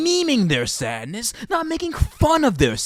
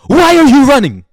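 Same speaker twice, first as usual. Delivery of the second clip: the same question again, shouted much louder; shouted repeat; 11 dB louder than usual. Real, not acted. Demanding why people run from their sadness, shouting. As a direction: yell the question at them.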